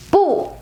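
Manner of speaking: "Bu" falling steeply from high; fourth tone. Shown as a demonstration. Teacher repeating the original tone of bu: fourth tone on "Bu", high fall. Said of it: "is originally four tone".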